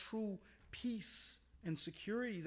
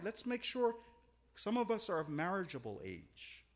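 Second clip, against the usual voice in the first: usual speaking voice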